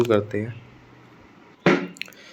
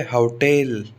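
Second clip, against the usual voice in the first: Hotel slowly, with a long stressed टेल; stress on second syllable; much slower than usual. Shown as a demonstration. Teacher repeating the exception, hotel stressed on टेल. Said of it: "टेल पर stress आता है".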